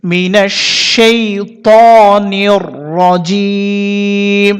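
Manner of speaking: slow recitation phrase by phrase with pauses; broken into parts. Shown as a demonstration. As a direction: recite it piece by piece, slowly. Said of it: "ভেঙে ভেঙে একবার বলে দিতেছি". (ভেঙে ভেঙে ধীরে তিলাওয়াত)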